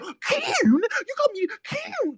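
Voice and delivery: very high, drawn-out mocking wail; mimicked yelling. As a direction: imitate his yelling in a stretched falsetto. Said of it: "hear you fucking yell".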